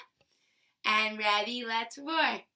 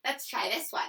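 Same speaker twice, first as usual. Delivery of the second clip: ordinary delivery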